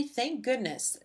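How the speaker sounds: plain reading voice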